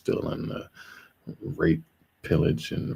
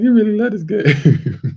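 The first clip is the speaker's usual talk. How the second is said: grandma; 9 dB louder than usual. Retold or imitated. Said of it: "She like".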